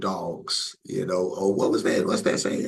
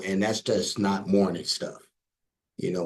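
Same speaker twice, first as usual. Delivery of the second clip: ordinary delivery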